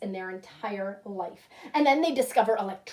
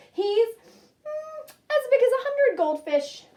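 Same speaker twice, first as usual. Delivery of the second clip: high, loud eager exaggeration; eager boast; 12 semitones higher, 7 dB louder, slower than usual. Boy boasting to his friend, high and eager. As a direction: puff it up proudly.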